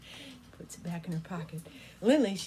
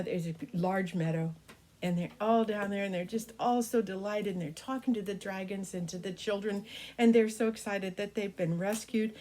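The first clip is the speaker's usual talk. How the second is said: ordinary delivery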